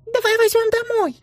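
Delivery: put-on high eager child voice; pestering child. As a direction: beg mum to take it home. Boy asks to keep hedgehog. (канючащий ребёнок)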